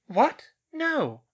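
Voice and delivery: put-on high, loud girlish protest; startled denial. Schoolgirl denies a portrait's accusation, startled. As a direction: blurt it in alarm, voice shooting up.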